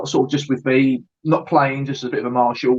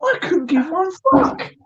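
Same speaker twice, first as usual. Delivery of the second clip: mock defiance; 14 semitones higher, slower than usual.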